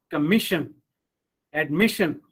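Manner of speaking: comMISsion, adMISsion; stress before the suffix. Shown as a demonstration. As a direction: punch MIS in commission and admission. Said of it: "the syllable that comes immediately before these suffixes".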